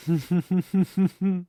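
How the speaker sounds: a smug villain's amused chuckle; mocking laugh. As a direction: laugh at the hero's answer, savouring it. Villain mocks hero's answer.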